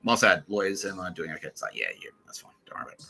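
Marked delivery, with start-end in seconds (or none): 1.8-3.0 dismissive murmur. Retold or imitated. Lawyers dismissively reassuring him.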